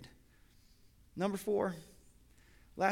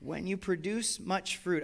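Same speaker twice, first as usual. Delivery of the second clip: ordinary delivery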